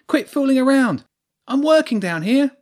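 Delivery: high, loud scolding shout; angry shout. A narrator's reading. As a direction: yell up at the culprit from below. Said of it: "she shouted up at him".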